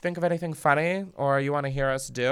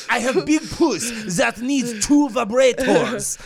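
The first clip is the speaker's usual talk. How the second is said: higher, laughing through a mock Russian accent; 9 semitones higher, 4 dB louder, slower than usual. Cohost joins the Russian bit, laughing through a hammed-up accent. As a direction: ham up the accent while cracking up. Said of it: "impressions episode".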